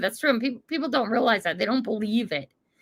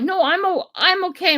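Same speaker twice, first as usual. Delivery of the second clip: flustered reassurance; 7 semitones higher, 5 dB louder than usual. Retold or imitated. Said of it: "I'm like".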